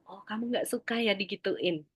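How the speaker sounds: soft, calm parental echo; quiet, understanding acknowledgement; parent mirroring back. Acted. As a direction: gently reflect the child's feeling back. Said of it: "kita ulang".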